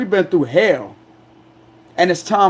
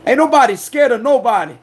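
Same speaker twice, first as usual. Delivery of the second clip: usual speaking voice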